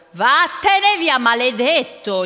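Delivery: loud, slow, high cry of rage; old man yelling to drive away an ogre; angry shout. A narrator's reading. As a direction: shout the curse, stretching each word. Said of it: "gli gridò".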